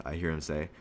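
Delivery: plain reading voice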